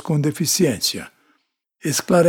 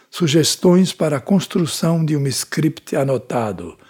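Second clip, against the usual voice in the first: ordinary delivery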